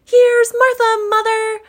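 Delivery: two excited little ones shouting together; high shrill children's shout; children crying out. Acted. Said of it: "cried the two young Cratchits".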